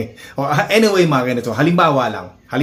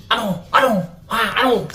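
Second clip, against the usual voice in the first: higher, taunting pretend squaring up; pitched-up mock challenge to the thief; 9 semitones higher than usual. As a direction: goad him to fight.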